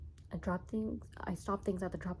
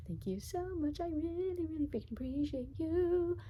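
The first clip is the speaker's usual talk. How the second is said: gushing; 10 semitones higher than usual. Real, not acted. Gushing thanks to viewers.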